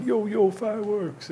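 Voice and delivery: slow, high call of a street hawker. Retold or imitated. Retelling fireworks sellers in Chinatown calling out, in a slow, high street hawker call. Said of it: "Italian guys cruising around going".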